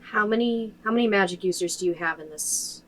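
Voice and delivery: loud, pressing question; urgent demand. Acted. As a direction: push him hard for answers. Player demands info loudly.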